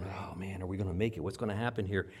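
soft, low, acted fretting; low worried muttering. Rower pulling against the waves, worried murmur. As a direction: mutter it under your breath.

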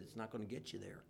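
quiet aside. Retold or imitated. Jesus voiced quietly.

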